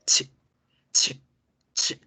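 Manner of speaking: CH as short unvoiced sneeze bursts; sonido de estornudo. Shown as a demonstration. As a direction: puff out a short CH, no voice. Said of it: "Es un estornudo". (CH corto y sordo, como estornudo)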